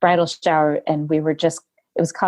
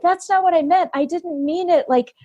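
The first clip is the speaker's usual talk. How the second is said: flustered denial; 11 semitones higher than usual. Retold or imitated. Guest flustered apology.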